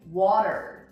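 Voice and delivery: water with the T flapped like a quick D. Shown as a demonstration. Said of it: "make this into a D sound".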